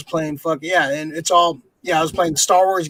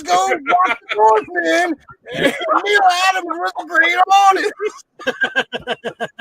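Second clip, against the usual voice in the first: loud slow high shouting interpreter; Garrett Morris; 12 semitones higher, 4 dB louder, much slower than usual. Retold or imitated. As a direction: shout each word slowly as if for the hard of hearing. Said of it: "you're like Garrett Morris on the old Saturday Night Live".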